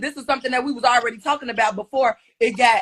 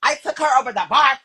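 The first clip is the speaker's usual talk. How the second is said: mocking Slim's bragging. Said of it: "that bitch had".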